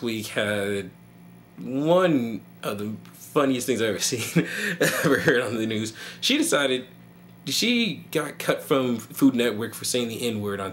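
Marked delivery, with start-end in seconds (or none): none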